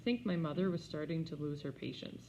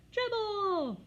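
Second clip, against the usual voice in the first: put-on high, loud childish yell; hollering toddler; 5 dB louder than usual. A narrator's reading. Fudge demanding the turtle, high loud holler. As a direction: holler the name. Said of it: "Fudge hollered".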